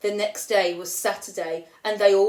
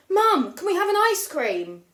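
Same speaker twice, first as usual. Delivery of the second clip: put-on eager child asking; 9 semitones higher, 4 dB louder than usual. Bella begging Mum for ice cream.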